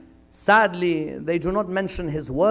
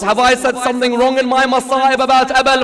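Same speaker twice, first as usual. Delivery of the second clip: higher, louder lamenting narration; mournful preaching; 7 semitones higher, 9 dB louder than usual. Real, not acted. Preacher retelling his dream dialogue with Fatima, mournful preaching. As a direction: press on with the sorrowful tale.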